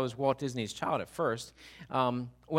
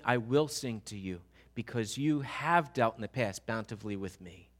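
ordinary talk

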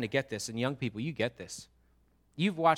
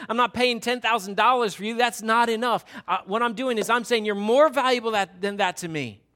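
loud, higher, full-force preaching as God; God proclaiming; 7 semitones higher, 8 dB louder than usual. Acted. Preacher voicing God to the congregation, loud passionate proclamation. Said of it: "And here God says".

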